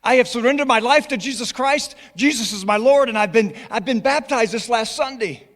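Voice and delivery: high, loud, joyful mock Scottish; exuberant, badly faked Scottish voice; bad Scottish accent. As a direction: imitate an overjoyed Scottish student proclaiming news. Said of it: "I have a brutal Scottish accent".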